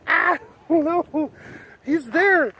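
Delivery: high-pitched mock fangirl panic; panicked fan. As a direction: imitate a starstruck fan squealing in alarm. Acting out the startled fan.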